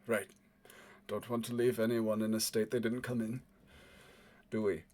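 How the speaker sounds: low, soft warning. Acted. Departing man to his captor, quietly warning.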